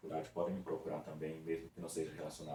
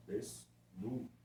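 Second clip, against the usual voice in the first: Desnudo drawn out softly with a rise; teacher slowly repeating Desnudo; slow sounding out; 5 dB quieter, much slower than usual. Shown as a demonstration. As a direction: stretch Desnudo gently to show the middle cluster. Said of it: "encontro consonantal na segunda sílaba".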